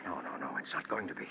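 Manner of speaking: breathless, hushed denial; whispered no, no, no; frightened whisper. Acted. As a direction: whisper it in dread. Panicked denial of the confession.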